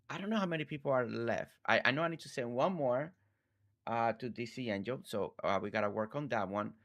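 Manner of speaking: normal talking voice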